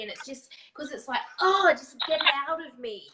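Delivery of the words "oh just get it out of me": loud, fast burst of frustration; fiery outburst. Acted. Voicing anger that wants out of the body, fiery outburst. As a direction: blurt it out like anger escaping. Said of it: "anger comes out really quick and it's fiery".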